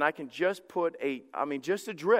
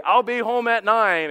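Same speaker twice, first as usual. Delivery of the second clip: mock yell, slow and louder; all-caps yelling; 3 semitones higher, 6 dB louder than usual. Husband texting when he will be home, mock-yelled. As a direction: call it out slowly like a text in capitals. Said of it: "all caps meant that you were yelling".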